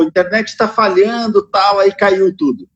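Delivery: half robot voice, glitchy connection. Pretend. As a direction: fake a breaking-up robot voice. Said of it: "eu vou começar a fazer aquela voz de robô".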